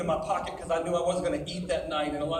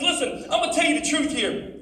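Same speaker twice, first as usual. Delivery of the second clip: higher, louder preaching read; preaching scripture read; 6 semitones higher, 7 dB louder than usual. Acted. Preacher reading John ten to teens, higher and louder. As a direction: proclaim the passage to the crowd.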